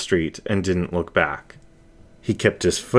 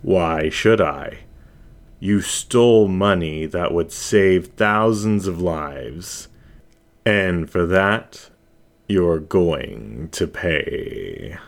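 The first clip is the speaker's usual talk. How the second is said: menacing shadow voice; slower than usual. Acted. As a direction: savour each word like a hunter cornering prey. Shadow voice threatens.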